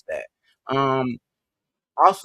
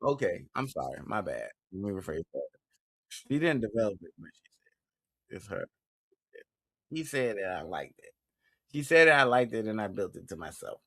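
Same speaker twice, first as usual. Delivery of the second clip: quiet; 3 semitones lower, 7 dB quieter than usual. Real, not acted. Quiet correction after laugh.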